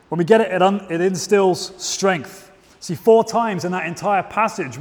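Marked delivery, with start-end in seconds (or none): 0.9-2.5 slow emphasis. Shown as a demonstration.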